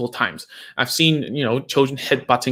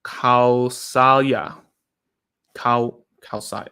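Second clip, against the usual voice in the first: Kausalya said very slowly, syllable by syllable; careful sounding-out; much slower than usual. Real, not acted. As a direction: sound out Kausalya carefully, stretching each part. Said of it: "I need to get your name right".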